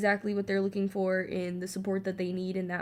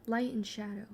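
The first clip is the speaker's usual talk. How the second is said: ordinary talk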